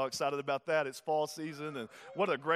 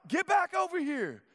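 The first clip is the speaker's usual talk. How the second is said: high, loud call of a cross father; mock stern yell; 11 semitones higher, 4 dB louder than usual. Father calling his escaping daughter back, mock stern yell. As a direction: bark it like a dad calling his runaway toddler. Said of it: "I'm not like".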